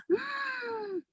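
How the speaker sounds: surprised gasp. Acted. Letter blown toward the car.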